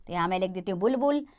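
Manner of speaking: ordinary delivery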